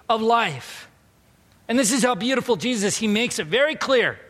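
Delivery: high, emphatic preacher's voice; impassioned preaching. Acted. Pastor welcoming complaints about his whining for Jesus, impassioned preaching. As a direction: lift the pitch and preach it.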